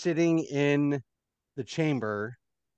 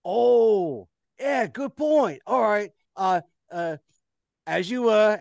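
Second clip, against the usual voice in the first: a hint of an eager guard, higher; 8 semitones higher, 5 dB louder, slower than usual. Guard thanks the maid, eager and higher. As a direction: perk up at the tip.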